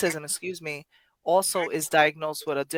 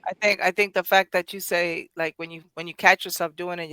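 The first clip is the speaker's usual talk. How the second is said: ordinary talk, nothing marked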